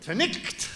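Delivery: "tonight" as "tonicht", short i and throaty ch; Scottish "tonight" with a guttural ch before the t; Scots pronunciation. Acted. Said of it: "some Scottish poetry".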